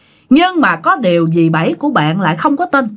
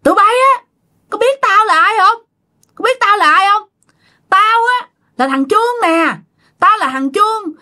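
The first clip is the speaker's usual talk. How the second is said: very high, plaintive spirit voice; possessed by dead man; 10 semitones higher than usual. Retold or imitated. Dead son asks for his mother, high pleading voice. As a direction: speak as the returned spirit, pitched way up. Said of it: "mà ngồi chỗ mới hỏi vậy nè".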